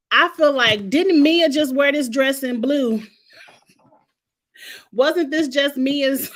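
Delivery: wait, haven't we seen this; surprised recognition. Real, not acted.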